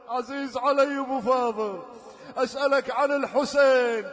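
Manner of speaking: ordinary delivery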